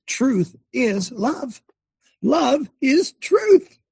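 very slow, rising to a high cry; proclaiming. Real, not acted. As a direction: lay down each word slowly.

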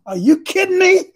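high, loud "are you kidding me" in a traffic jam; exasperated outburst. Retold or imitated. Preacher stuck in traffic, yelling in exasperation. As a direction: blow up in frustration at the brake lights.

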